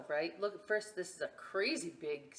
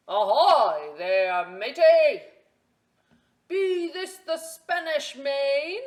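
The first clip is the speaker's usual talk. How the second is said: slow, loud, high pirate holler; pirate yell; 8 semitones higher, 18 dB louder, slower than usual. Acted. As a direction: bellow across the beach like a captain. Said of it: "the head pirate climbed out of the boat and yelled".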